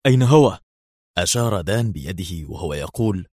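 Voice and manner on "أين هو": tense. Acted. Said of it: "في توتر بالغ".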